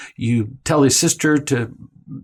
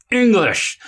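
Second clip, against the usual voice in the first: sharp, much higher exasperated bark; snapping; 8 semitones higher than usual. Shown as a demonstration. Parent barking at whining Billy, exasperated snap. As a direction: snap the word at him like an irritated parent. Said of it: "it's not gonna work".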